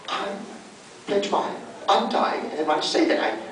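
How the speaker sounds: breathy, raspy villain whisper; sinister mummy villain vow; Wrath voice. Pretend. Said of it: "In your rat's voice".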